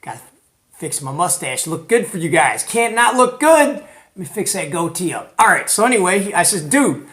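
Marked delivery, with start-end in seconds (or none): none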